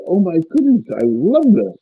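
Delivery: rising, thrilled and wanting; newly sober man gushing to his mentor; eager joy. Retold or imitated. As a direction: burst out with eager delight. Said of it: "I said".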